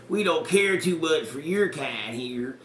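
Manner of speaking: slow, drawn-out menacing local. Retold or imitated. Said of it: "You know, that card".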